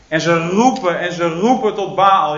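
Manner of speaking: ordinary talk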